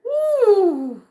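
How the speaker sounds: high, loud whooping cheer; excited whoop. Real, not acted. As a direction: let out a joyful woo. Whooping as energy hits.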